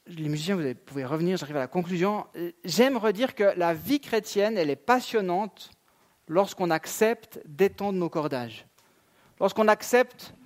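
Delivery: ordinary delivery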